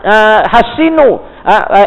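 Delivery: normal talking voice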